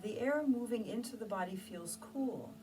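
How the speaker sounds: hushed calming guidance; guided meditation. Acted. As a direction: guide them into stillness, gently. Leads a meditation.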